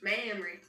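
Mamry drawled with a wide "mam"; Mamry as a Southern-accented Memory; southern pronunciation. Retold or imitated. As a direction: echo Mamry like "mammary" in a Southern twang. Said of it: "gonna call herself Mamry".

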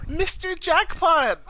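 very high, drawn-out old lady imitation; high-pitched mock old lady greeting; old lady calling. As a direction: call out slowly in a high voice. Acting out old lady's greeting.

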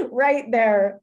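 laughing it out, loud and high. Real, not acted. Mom laughs at her daughter's sweet compliment. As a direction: say it through real laughter.

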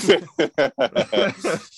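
high-pitched, sustained laughter; real laugh. Real, not acted. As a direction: break into laughter. Shared laugh before answering.

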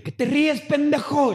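high, sharp angry challenge; angry retort. Retold or imitated. Confronting his nervously laughing friend, angry. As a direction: snap at him for laughing.

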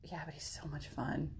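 hushed guilty whisper. Real, not acted. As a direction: whisper it like a confession. Guilty admission.

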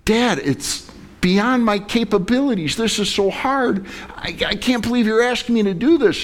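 high-pitched overwhelmed protest to Dad; pleading son. Acted. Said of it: "the son looks up at his father".

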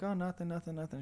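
high, hurried covering up, mock innocent; feigned innocence. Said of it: "I'm like".

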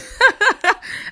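short high burst of laughter. Real, not acted. Laugh after phrase.

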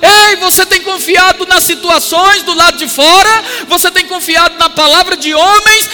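high, loud, driving sermon voice; impassioned preacher at the peak of a sermon; full-force preaching. Real, not acted. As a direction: press every phrase on the congregation at a near shout.

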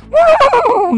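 mock gasp. Crude joke about pony fans.

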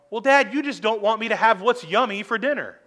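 a pastor lightly playing a sulking child; whiny child. Pastor imitating his child arguing with dad, a mild whiny kid voice.

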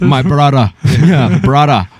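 put-on African accent. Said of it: "I want that deep African accent".

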